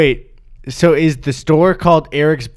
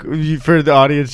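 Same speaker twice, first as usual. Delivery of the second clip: ordinary delivery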